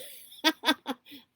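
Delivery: bright high laugh at herself; amused laugh. Real, not acted. Laughing at own hiccups.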